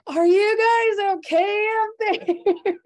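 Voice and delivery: a neighbour shouting high across the water; worried neighbour calling out. Retold or imitated. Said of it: "they're like".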